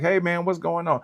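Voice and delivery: put-on light up greeting the kids; friendly greeting. Greeting grandkids before.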